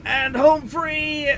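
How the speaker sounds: high, slow relieved shout; triumphant cheer. Real, not acted. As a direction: cheer the escape. Escaping the storm.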